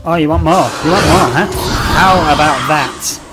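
loud mocking shout; shouting taunts at the alien. Real, not acted. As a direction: jeer at the monster loudly.

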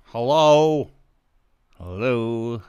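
calling out loudly into the mic; loud hello. Real, not acted. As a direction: call out to check you're heard. Testing if the mic works.

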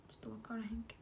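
voiceless aside to herself; a whispered aside while drawing. Real, not acted. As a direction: drop to a whisper, thinking aloud.

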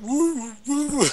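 high wailing siren "woo"; alarm imitation. Imitating the bank's alarm, high siren wail.

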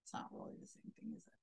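a knowing whisper to the viewers; whispered aside. Real, not acted. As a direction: drop to a wry whisper.

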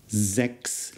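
ordinary talk

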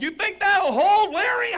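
high, drawn-out shout to a coworker; calling out. Acted. Mechanic gluing the plane yells to Larry, high holler. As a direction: holler it slowly across the tarmac.

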